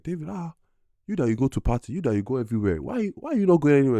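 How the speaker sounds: put-on high, pleading mother voice, with an African accent; a mom begging her son to leave the house, pitched high; imitating his mom.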